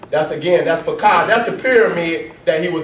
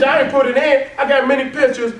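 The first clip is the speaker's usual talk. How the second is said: ordinary delivery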